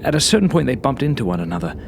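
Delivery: ordinary delivery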